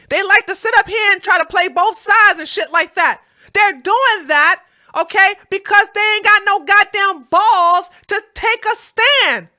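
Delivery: ordinary talk, nothing marked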